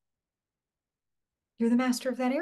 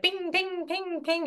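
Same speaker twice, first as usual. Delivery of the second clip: light, higher sing-song pings, a touch softer; imitated phone pings; 8 semitones higher, 4 dB quieter, much slower than usual. Coach mimics a client's constantly pinging phone, light and higher. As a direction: chirp the text alerts like a buzzing phone. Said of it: "when I just hear my phone constantly".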